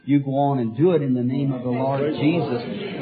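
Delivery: normal talking voice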